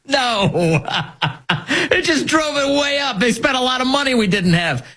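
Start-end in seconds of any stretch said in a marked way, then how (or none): 0.1-3.2 high-pitched, laughing through the words; real laughter at a ridiculous question; laughing scornfully. Real, not acted. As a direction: crack up while mocking it. Mocking laughter at the act's name.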